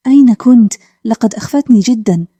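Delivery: ordinary delivery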